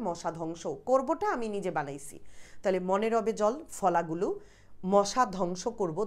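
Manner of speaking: ordinary talk, nothing marked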